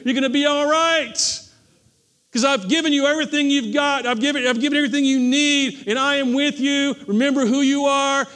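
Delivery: high, loud, reassuring proclamation; the Lord's voice. Acted. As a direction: speak as God lifting Gideon up. Voicing the Lord to Gideon.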